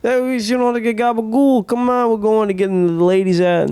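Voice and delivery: high-pitched hammed-up Italian-American wiseguy; Italian-American mobster accent. Mobster coaxing his pal along, Italian-American accent. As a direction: put on a Jersey Italian mob accent, high. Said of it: "I'm not doing the accent".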